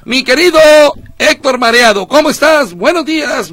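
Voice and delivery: loud, high on-air hail; calling out on air. Real, not acted. Hails the reporter on air. (saludo en voz alta y aguda)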